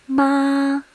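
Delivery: Ma held high and level; first tone. Shown as a demonstration. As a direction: say Ma on one steady high note. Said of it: "this is how we mark the tones".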